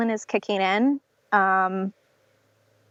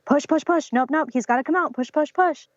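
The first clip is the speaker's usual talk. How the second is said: higher, nagging mock coaching; pushy midwife; 6 semitones higher than usual. Midwife nagging mother to keep pushing, mock pushy voice. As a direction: nag her to push again. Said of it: "she's on my ass again".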